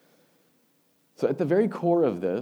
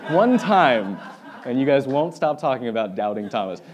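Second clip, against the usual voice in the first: laughing; 2 semitones higher, 10 dB louder than usual. Real, not acted. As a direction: say it while cracking up. Laughing while retelling Thomas joke.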